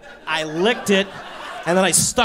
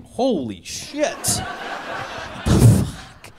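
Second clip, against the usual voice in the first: stunned, long-drawn-out swearing; acted shock; 4 semitones higher, much slower than usual. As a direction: stretch each word in mock astonishment. Retold shock at drunk naked buddy.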